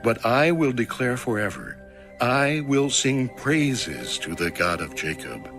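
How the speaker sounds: ordinary talk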